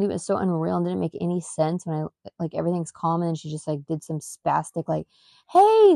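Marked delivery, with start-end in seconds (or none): 5.5-5.9 high, loud squeal; imitating daughter's loud startled hey. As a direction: yelp it like a surprised child. Retelling child's sudden shout.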